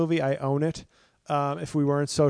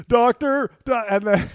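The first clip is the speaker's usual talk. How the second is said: high loud frantic call; frantic Jeff Bridges; 8 semitones higher, 8 dB louder than usual. Retold or imitated. Father bursting into hospital, high frantic call. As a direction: burst in calling for a doctor. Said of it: "He's like".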